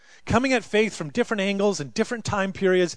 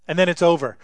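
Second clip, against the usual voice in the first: normal talking voice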